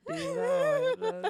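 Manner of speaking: loud protest. Real, not acted. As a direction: yell it out in protest. Friends tease about first impression.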